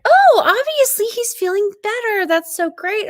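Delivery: imitating a friend's relieved delight; hopeful excitement. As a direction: gush that the bat is better. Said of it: "And so Parker's like".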